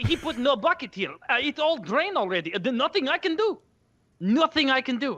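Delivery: the Bosnian repairman upset at the damage; agitated Eastern European accent. Retold or imitated. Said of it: "that Eastern European accent".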